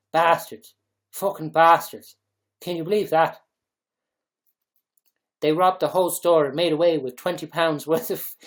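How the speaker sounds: loud, mock-indignant Dublin accent. Mock Dubliner cursing the Lidl looters, loud Dublin accent. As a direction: rant like a scandalised Dubliner. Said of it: "put Dublin accent into".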